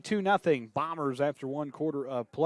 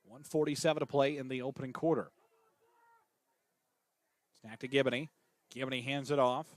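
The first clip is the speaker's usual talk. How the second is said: steady radio play-by-play call, tracking each snap; live play-by-play. Acted. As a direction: call the game for listeners at home.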